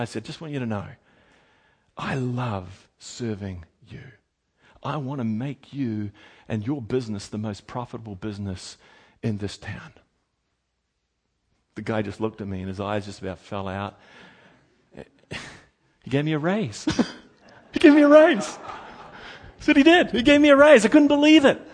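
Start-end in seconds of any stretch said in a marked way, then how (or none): 0.3-9.9 quiet sincere pledge. Retold or imitated. Quiet sincere words to boss. 17.7-20.6 much higher and louder, laughing through it; gleeful, laughing retelling; delighted laughing exclamation. Real, not acted. Laughing joyful repeat.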